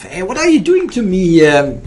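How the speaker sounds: loud, high exasperated protest; excited outcry. Real, not acted. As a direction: cry out at the board in disbelief. Opponent plays strong moves, outcry.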